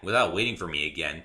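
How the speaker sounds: ordinary delivery, nothing marked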